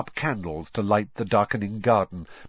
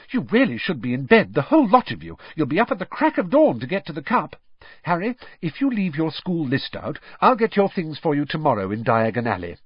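higher, louder fussing mum; mother fussing, brisk and loud; bustling mother; 7 semitones higher, 3 dB louder than usual. Acted. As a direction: shoo the children off to bed. Mother packing children off to bed.

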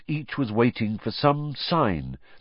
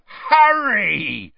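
high, loud drawn-out cry of greeting; delighted shout; 13 semitones higher, 7 dB louder than usual. A narrator's reading. Hagrid spots Harry at his door, shouting joyfully. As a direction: shout the name in joyful welcome.

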